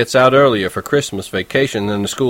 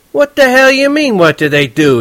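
much higher, louder yell; 13 semitones higher, 5 dB louder than usual. Acted. Horwitz yelling at Holden from the front seat. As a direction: yell back at a dumb question. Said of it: "he yelled at me".

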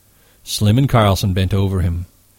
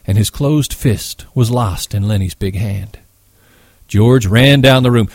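usual speaking voice

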